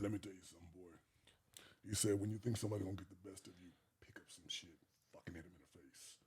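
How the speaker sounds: very low, hushed whisper; conspiratorial stepdad. Retold or imitated. Gangster stepdad advising a boy on fighting, hushed. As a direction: imitate a menacing confidence, almost under the breath.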